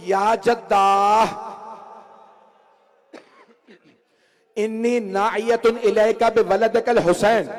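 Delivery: high, loud wailing sermon voice; preaching lament. Acted. Preacher wailing Zainab's lament to the mourners. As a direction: climb into a keening cry of grief.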